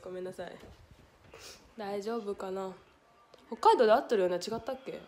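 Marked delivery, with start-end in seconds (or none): none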